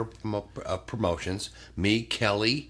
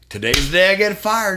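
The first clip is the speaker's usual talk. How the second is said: higher, louder proclamation; dramatic announcement; 10 semitones higher, 9 dB louder than usual. Retold or imitated. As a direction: announce your doom with gusto. Announcing his firing on arrival.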